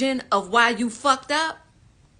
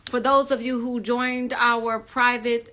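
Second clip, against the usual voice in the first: ordinary talk, nothing marked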